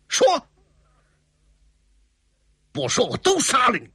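high, forceful threat; angry threat. A narrator's reading. Swordsman threatening women who dare not speak, angry threat. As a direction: order them to talk or else.

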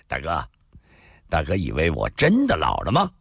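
mild acted amusement; light chuckle. A narrator's reading. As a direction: chuckle lightly, then ask low. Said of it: "李寻欢笑了".

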